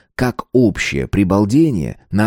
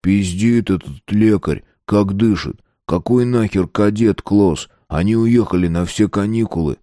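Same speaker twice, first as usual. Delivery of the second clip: ordinary delivery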